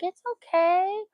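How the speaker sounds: high, slow, reassuring coo; gentle coaxing. Retold or imitated. Retelling how she soothed the scared stray, gentle coaxing. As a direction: coax a scared animal out of hiding.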